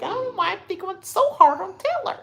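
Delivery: high, put-on girlish whine; mock her fretting in a high voice; mocking housemate impression. Mimics housemate obsessed with [name].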